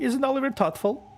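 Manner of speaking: put-on high, sing-song bratty kid; smug child voice. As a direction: brag like a spoiled child. Oliver praises himself smugly.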